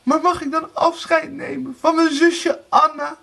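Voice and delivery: high, tearful request; sobbing plea. A narrator's reading. Erika asks to see her sister, sobbing. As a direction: beg in a high wail. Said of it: "snikte Erika".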